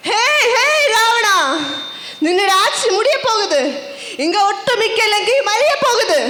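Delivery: theatrical warning to a tyrant; ringing, declamatory stage challenge; challenger's proclamation. Acted. Challenger proclaims Ravana's doom.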